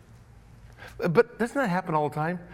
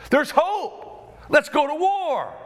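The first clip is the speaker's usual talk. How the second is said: high, loud, slow battle call; rallying cry; 12 semitones higher, 4 dB louder, much slower than usual. Acted. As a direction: rouse the troops to war.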